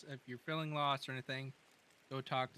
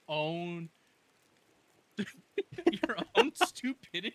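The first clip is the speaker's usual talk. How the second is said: laughing; 5 semitones higher, 6 dB louder, much slower than usual. Real, not acted. Slow laughing jab.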